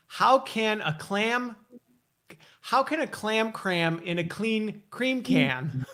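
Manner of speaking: slow, careful enunciation of each word; slow tongue twister demo. Shown as a demonstration. Host slowly demonstrating a tongue twister, careful enunciation. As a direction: spell it out slowly for him. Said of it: "this one you don't have to do it five times".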